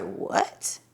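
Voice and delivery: breathless whispered disbelief; a winded, barely-there what; stunned what. Retold or imitated. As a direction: gasp the word out in shock. Husband suddenly asks for divorce.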